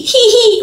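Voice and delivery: hammed-up giggle. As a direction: overdo the giggle, laughing through it. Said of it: "It's H E E space H E E".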